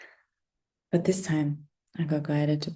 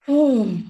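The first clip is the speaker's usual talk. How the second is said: shocked gasp; 5 semitones higher, 6 dB louder than usual. Real, not acted. Remembered shock.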